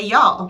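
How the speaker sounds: one syllable "Y'all", a bit loud; drawled y'all. Shown as a demonstration. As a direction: say "Y'all" slow and Southern. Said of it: "We say".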